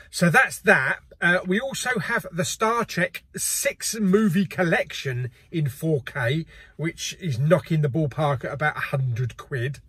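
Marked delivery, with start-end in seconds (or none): none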